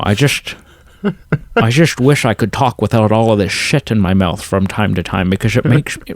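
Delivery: slushy Scottish impression, s turning to sh; Sean Connery. Podcaster contrasts a famous actor with typical Scots, slurred impression. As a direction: talk as if your mouth is full, in a Scottish accent. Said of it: "Whereas Sean Connery's just very".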